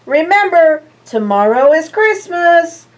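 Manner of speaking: high, loud goodbye; bright-toned Santa calling goodbye; cheerful Santa farewell. Acted. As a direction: call it out from the balloon. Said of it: "said Santa".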